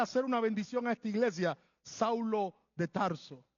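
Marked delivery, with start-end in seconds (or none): none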